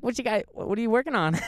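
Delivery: high, loud mock kid; imitating a twelve-year-old butting in; bratty kid voice. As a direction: pitch it up like a nosy kid.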